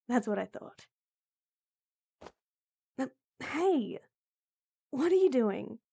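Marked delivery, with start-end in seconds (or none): 3.0-5.8 startled. Acted. Startled by his action.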